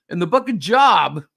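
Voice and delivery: "Job" with a short o, like employment; mispronounced Job. Retold or imitated. Retelling Bible study blunder, "Job" mispronounced to rhyme with rob. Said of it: "J O B".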